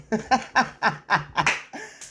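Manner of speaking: a high, bright giggle. Real, not acted. Vlogger giggling about Alicia, a high laugh. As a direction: giggle at how cute she is.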